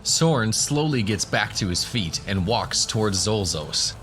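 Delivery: normal talking voice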